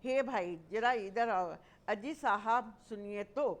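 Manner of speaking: calling out. Shown as a demonstration. Teacher demonstrating calling someone over. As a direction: hail someone politely from a distance. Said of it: "संबोधन कर रहे हैं उसे".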